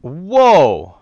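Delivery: loud, high startled whoa; surprised whoa. Real, not acted. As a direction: jolt of real surprise. Sudden frame rate surprise.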